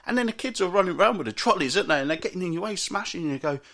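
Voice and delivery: ordinary delivery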